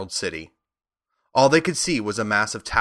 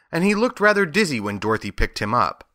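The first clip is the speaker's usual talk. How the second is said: ordinary delivery, nothing marked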